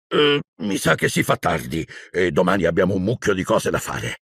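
ordinary delivery

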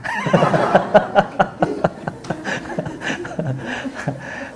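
real laughter. Real, not acted. Laughing at his own story.

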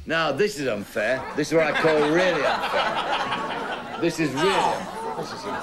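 slow, giggling through each word; teasing, amused complaint; laughing mock protest. Real, not acted. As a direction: laugh while pretending to be wronged. Host laughing at contestants' protest.

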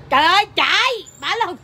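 high, loud mock-alarmed outcry; exclaimed alarm. Friend retelling everyone running off, high loud exclamation. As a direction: burst out high and loud, acting it out. Acted panic retelling the getaway.

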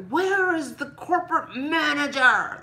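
hammed-up demand for the manager; mock outrage. Playing an outraged shopper demanding Walmart's manager, in mock anger. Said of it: "That's what I wanted to say".

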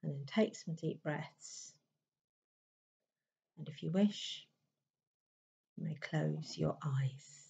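calm, unhurried meditation voice with long pauses; even, settled delivery in short, spaced phrases; guided meditation. Acted. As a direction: gently guide them inward, leaving space between phrases.

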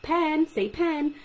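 slow, loud, high drilling Pen, say pen; prompting a child to repeat. Shown as a demonstration. Speech therapist showing parents drilling, slow. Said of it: "now want you to say it".

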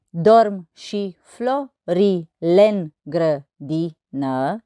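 very slow, each syllable separated. Shown as a demonstration. Teacher counting verse syllables on fingers, syllable by syllable. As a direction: count out the syllables one at a time. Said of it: "Să observăm numărând".

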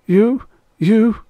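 high, loud, choked stammer; horrified stammer. Acted. Rye aghast at Extroni, high and stammering. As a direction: stutter in shock. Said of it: "Rye was greenish".

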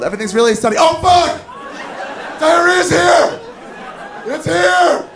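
jumps from breezy to high panicked shriek; cheerful then suddenly screeching in alarm. Acted. Diarrhea suddenly arrives, panicked.

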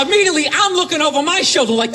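normal talking voice

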